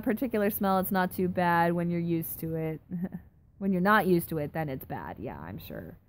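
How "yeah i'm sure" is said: ordinary talk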